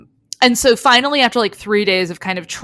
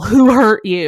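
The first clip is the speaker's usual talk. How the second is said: retold alarmed demand; 3 semitones higher, 6 dB louder than usual. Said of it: "goes full".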